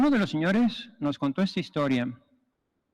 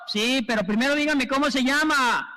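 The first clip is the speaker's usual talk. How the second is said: mock questioning; 8 semitones higher than usual. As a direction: calmly ask his name over the cries. El señor repite la pregunta. (voz aguda y pausada, preguntando el nombre)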